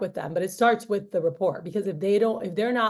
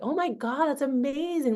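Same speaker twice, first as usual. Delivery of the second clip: higher, gushing wonder; impressed; 6 semitones higher than usual. Retold or imitated. Parent gushing over a hardworking student, impressed. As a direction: pitch jumps up in delight.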